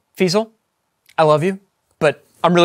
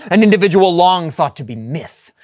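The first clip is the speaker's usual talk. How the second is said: ordinary delivery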